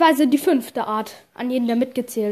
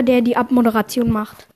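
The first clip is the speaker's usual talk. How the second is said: ordinary delivery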